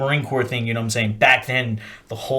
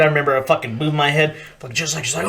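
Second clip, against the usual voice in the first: ordinary delivery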